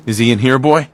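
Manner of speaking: loud, urgent call to the dog. Acted. Father calling to his dog at the door, loud. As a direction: call out to the dog, tense and eager.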